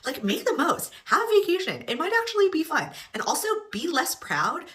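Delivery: high, exasperated plea; mock exasperated advice. Real, not acted. Scolding the girls, high pitched.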